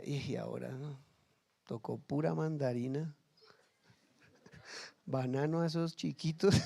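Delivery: hammed-up worry about feeding the kids; mock lament. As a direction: sigh it like a hard-up parent. Parent worrying over food costs.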